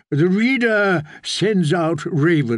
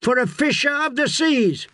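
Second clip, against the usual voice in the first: shouting back; 11 semitones higher than usual. A narrator's reading. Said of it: "Russ shouted back".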